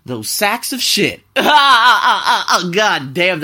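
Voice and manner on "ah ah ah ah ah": loud cackling laugh at his own joke; real laughter. Real, not acted. Host laughing at his own insult, loud cackle. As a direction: break into loud laughing bursts. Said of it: "that was funny".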